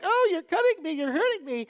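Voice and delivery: very high, loud mock wail; complaining plant. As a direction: wail like a pruned plant, hammed-up. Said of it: "if the plant were a-, alive, you'd be like".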